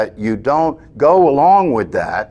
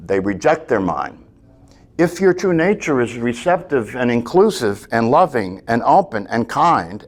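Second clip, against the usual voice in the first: normal talking voice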